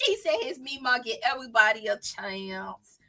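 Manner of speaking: ordinary delivery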